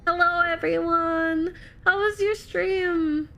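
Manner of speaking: high, slow sing-out to newcomers; excited welcome. Real, not acted. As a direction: welcome everyone in, stretching the words. Welcoming the arriving raiders.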